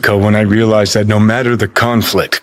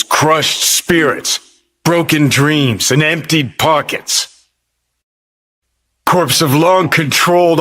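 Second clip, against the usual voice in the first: impassioned indictment; 6 semitones higher than usual. Acted. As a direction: pitch climbing into a higher, fervent speech. Impassioned rant against the corporations.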